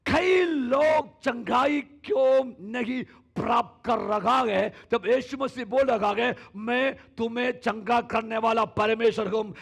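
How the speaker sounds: high, loud, slow preaching; full-force preaching. Real, not acted. Pastor preaching healing to the congregation, loud and high. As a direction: proclaim it to the whole church.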